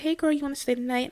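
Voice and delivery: put-on high, fast, bubbly girlfriend invite; imitating her friend. Friend asking her to sleep over, high and quick. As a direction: chirp it like the eager friend.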